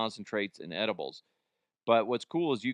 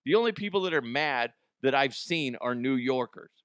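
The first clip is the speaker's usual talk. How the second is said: normal talking voice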